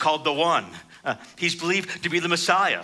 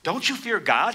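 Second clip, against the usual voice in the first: rebuke; 6 semitones higher than usual. Acted. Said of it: "rebuked him".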